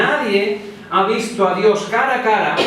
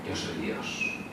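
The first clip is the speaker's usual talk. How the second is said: much softer, lower near-whisper; hushed exchange; 13 semitones lower, 19 dB quieter, slower than usual. Acted. Gideon asks and God answers, soft low whisper. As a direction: drop to a hushed low aside.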